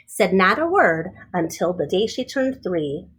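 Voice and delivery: plain reading voice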